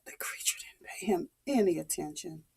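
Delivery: fast soft whisper. A narrator's reading. Storyteller telling the child the wolf ignored him, whispered. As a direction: whisper it quickly.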